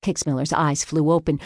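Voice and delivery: ordinary delivery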